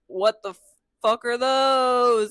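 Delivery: mock horror at the giants; shocked shriek. Tiny new humans see giants.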